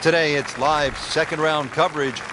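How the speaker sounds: broadcast announcer. Acted. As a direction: announce the show opening. Broadcast intro over music.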